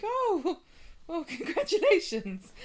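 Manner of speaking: high, laughing mock congratulations; laughing surprise. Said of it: "And Emma's like".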